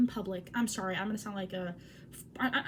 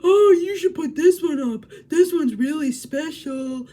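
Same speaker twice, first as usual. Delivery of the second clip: loud, higher gushing mock teacher voice; mocking the art teacher; 5 semitones higher, 12 dB louder, slower than usual. Mocking the awful art teacher praising the drawing, loud and gushing. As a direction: overdo a pushy teacher's fake praise. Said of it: "and she said".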